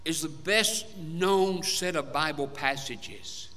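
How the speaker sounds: ordinary talk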